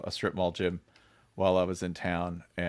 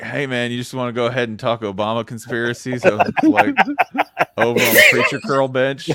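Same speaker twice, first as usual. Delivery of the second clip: mock uncle invite; 3 semitones higher, 9 dB louder than usual. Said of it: "were they like".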